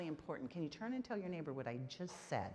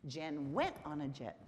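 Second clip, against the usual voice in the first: emphasize went to highlight the action; stress on went; 3 semitones lower than usual. Shown as a demonstration. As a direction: punch the word went, keep the other words low. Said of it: "The action is what we're emphasizing".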